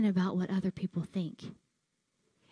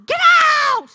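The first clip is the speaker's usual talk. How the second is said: much higher, much louder shouted order; retold scream; 19 dB louder than usual. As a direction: scream it as the furious trainer. Trainer yelling again, louder.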